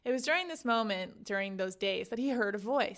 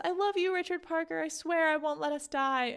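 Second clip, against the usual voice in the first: tender promise; 7 semitones higher than usual. Retold or imitated. Pi swears to the tiger.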